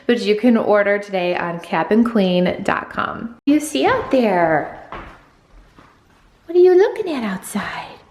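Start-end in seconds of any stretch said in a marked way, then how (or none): 3.5-8.0 high, sing-song voice for a pet; pet talk. Real, not acted. Talking to her pet at the window, high sing-song coo.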